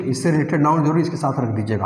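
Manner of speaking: normal talking voice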